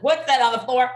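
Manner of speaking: high, loud mock outrage; shocked mom, loudly repeating the question; mother's alarmed echo. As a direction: exclaim it as an exasperated parent. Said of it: "And I'm like".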